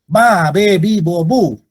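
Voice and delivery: ba, be, bi, bo, bu said one by one, loud and clear; syllable drill. Shown as a demonstration. Teacher drilling syllables aloud to students. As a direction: chant the syllables for the class.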